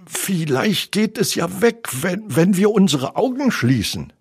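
high, timid story voice; anxious suggestion. Acted. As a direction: offer a hesitant, nervous hope. Frightened friends hope shadow goes away.

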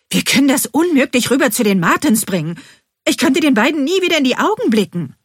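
high, loud, worked-up protest; agitated. A narrator's reading. Bean to her friend about facing the neighbours, agitated. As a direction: raise pitch and volume, upset. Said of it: "sagt etwas aufgebracht".

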